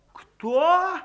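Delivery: a high one-word question of amazement; astonished. A narrator's reading. The women ask in amazement, high. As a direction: gape at him. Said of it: "изумились бабы".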